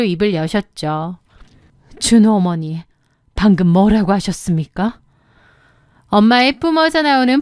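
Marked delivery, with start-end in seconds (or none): 2.0-5.0 low, icy challenge from a mother; cold, firm. A narrator's reading. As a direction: confront her with hard, low composure. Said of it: "아주 차갑고 단단한 목소리로". (차갑고 단단한 엄마 목소리)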